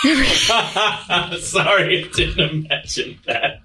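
laughing through an apology; can't stop laughing. Real, not acted. Bursts out laughing at story.

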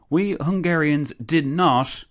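high, slow, emphatic exclamation; exclaiming. A narrator's reading. Countess protests the Habsburg coup to the council, exclaiming. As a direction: proclaim it indignantly, drawing out each word. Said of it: "the Countess exclaimed".

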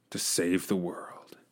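whispered solemn line. Acted. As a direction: whisper it with gravity.

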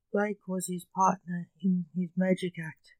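plain reading voice

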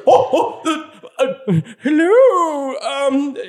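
awkward high fumbling greeting; Jarvis voice. Acted. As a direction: stammer it out high and slow. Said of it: "And he goes".

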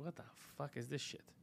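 quiet mock disgust; muttered disgust. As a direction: grumble it at the cheap dessert. Disgust at the dessert.

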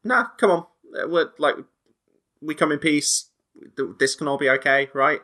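lightly voiced nervous pilot; pleading pilot. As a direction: try to talk the aliens down. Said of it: "and say".

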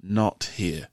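low, hushed, slow warning; quietly steer them off the subject; Mazar, very serious. Acted. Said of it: "Mazar gets very serious".

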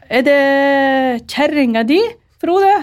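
high, drawn-out village woman's question; a nosy neighbour quizzing Frode about his new wife. Retold or imitated. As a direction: put on a chatty rural neighbour asking. Village women greeting the new bride.

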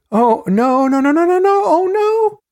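high, loud run of panicked no's; acted wail, far above the narrating voice; acted distressed cry. Narrator voices the victim's cries from the transcript, acted distress.

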